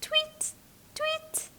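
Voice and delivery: very high, soft tweet; tiny high birdsong; bird tweet. Shown as a demonstration. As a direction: chirp like a little bird. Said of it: "The bird flutters".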